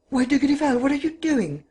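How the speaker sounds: high, alarmed cry. A narrator's reading. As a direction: cry out in sudden fear. Wife sees husband's revolver.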